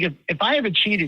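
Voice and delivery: ordinary delivery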